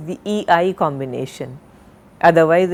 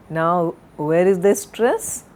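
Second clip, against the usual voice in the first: ordinary talk, nothing marked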